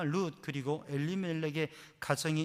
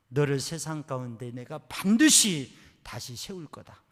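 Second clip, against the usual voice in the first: voice of God; 2 semitones higher, 15 dB louder than usual. Retold or imitated. God promising the daughter a future, loud. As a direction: declare it with authority. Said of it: "하나님의 음성".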